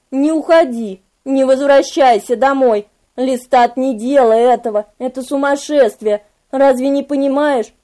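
a demon begging, understated; lightly voiced Memnoch, pleading. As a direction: implore him with restrained desperation. Memnoch pleads close at ear.

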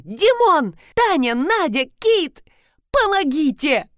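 high, urgent calls for help; yelling for help. A narrator's reading. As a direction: call out each name in alarm. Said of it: "заорала Рина".

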